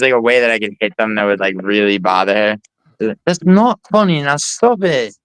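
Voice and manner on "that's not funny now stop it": put-on annoyed girlfriend. Imitating the girlfriend scolding him to stop flicking her earrings, mock annoyed. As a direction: play the irritated girlfriend telling him to quit.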